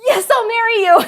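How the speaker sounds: high, loud shocked gasp of a yes; mock shock. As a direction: play it up as stunned joy. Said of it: "She's so shocked".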